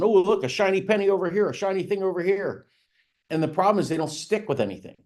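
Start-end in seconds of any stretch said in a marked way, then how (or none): 0.0-2.7 higher-pitched, lightly voiced scatterbrain; a hint of crazy-person excitement; mock crazy person. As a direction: imitate someone distracted by every shiny object. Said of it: "they're like a crazy person".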